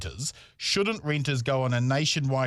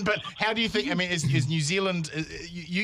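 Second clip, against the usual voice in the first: ordinary talk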